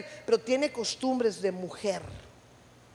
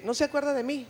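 usual speaking voice

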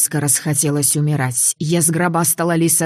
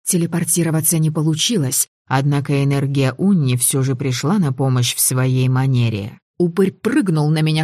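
ordinary delivery, nothing marked

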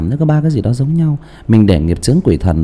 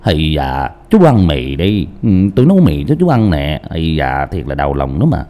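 Hong Kong accent; 7 semitones lower than usual. Shown as a demonstration. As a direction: play the old man with a Hong Kong accent. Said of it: "Đổi giọng thành tiếng Hồng Kông". (giọng Hồng Kông, trầm và nhỏ)

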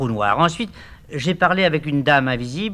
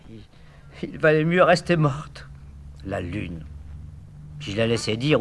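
ordinary delivery

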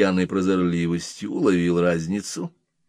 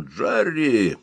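higher-pitched hoarse call of the name; 6 semitones higher than usual. A narrator's reading. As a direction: call out to the dog in a husky voice. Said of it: "крикнул заметно осипшим голосом". (оклик осипшим голосом)

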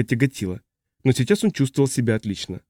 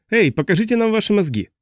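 high-pitched mock shout; crying out; 8 semitones higher than usual. A narrator's reading. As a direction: call up from the crowd without full volume. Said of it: "закричал мужчина". (высокий, несильный выкрик)

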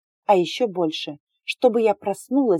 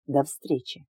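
lower, softer meaningful farewell; suggestive; 5 semitones lower, 5 dB quieter, slower than usual. Acted. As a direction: say it with a hidden hint. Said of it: "с намёком сказал".

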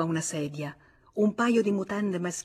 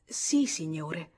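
very slow, choked reply; much slower than usual. A narrator's reading. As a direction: force the words out through a tight throat. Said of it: "con voce strozzata".

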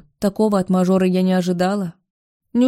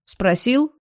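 «Спросил?» thrown up high on a rising tune; sharp rhetorical echo; 5 semitones higher than usual. Acted. Alex mocks his father's not asking, rising high. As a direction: fling «Спросил?» back, pitched up. Alex's pointed repeat.

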